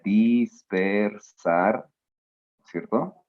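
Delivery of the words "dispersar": dispersar stretched very slowly, every sound held. Shown as a demonstration. Teacher showing the word's parts to students, very slow. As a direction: draw out dispersar syllable by syllable. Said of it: "Entonces, mira".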